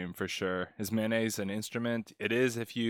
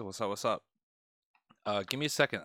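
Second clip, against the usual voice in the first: ordinary delivery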